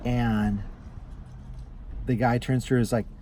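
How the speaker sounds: ordinary talk, nothing marked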